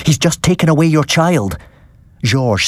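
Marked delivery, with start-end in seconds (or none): 0.1-1.6 high, alarmed shout; lightly voiced urgent alarm; urgent yell. A narrator's reading. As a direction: warn the father in a panic. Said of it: "I yelled".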